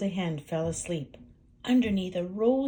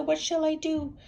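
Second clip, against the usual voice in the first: panicked hen; 9 semitones higher than usual. Acted.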